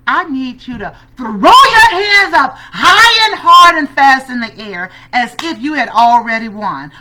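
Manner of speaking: loud, high burst of urging; big, high-pitched pep-talk yell; rousing coach shout. Retold or imitated. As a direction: shout it like a coach firing up a player. Coach acting out her rousing instruction.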